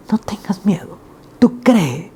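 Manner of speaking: whisper. Real, not acted. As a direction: whisper it to comfort. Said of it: "comforting whisper in sermon".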